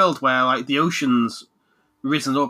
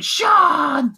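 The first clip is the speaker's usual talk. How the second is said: high, loud drawn-out call; mimicked game shout; 7 dB louder than usual. Player mimics glitched cutscene dialogue, shouting. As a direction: belt out the name like a glitching character. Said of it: "all the cutscenes, just going".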